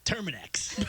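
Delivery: imitating a billboard announcer; mock ad read. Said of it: "he looks at billboards and he's like".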